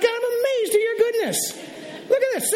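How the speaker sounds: very high, thrilled exclamation; acted amazement. Driver thanking God for green lights, hammed-up amazement. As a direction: squeal it upward in playful wonder.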